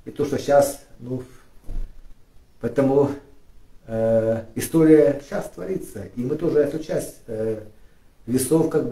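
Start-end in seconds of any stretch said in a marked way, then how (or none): none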